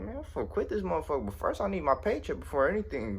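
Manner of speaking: high-pitched exasperated inner rant; acted fed-up. Unpaid worker thinking of quitting, acted exasperation. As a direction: act out fuming inside your own head.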